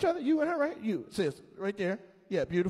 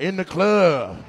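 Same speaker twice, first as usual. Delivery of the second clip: slow, louder drawn-out words; mock-proud slow answer; 10 dB louder, much slower than usual. Shown as a demonstration. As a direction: stretch each word out loud for the crowd. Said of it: "Where y'all meet at?".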